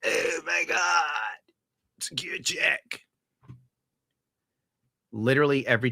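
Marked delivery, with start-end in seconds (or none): 0.1-1.4 slow, drawn-out mock crying gush; mock gushing fans. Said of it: "They're like". 2.0-3.0 slow, breathy whispered awe; mock whisper. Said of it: "They're like".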